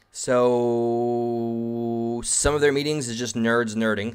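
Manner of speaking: drawn-out, very slow, mock-grand delivery; slow dramatic reread. Shown as a demonstration. Streamer rereading the line to chat as mock drama, very slow. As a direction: savour every word like a big reveal. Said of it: "Thank you for that".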